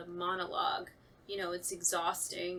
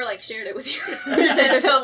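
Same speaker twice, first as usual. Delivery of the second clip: usual speaking voice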